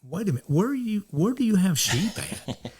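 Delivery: quick surprised question. Retold or imitated. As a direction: blurt it out in disbelief. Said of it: "And I'm like".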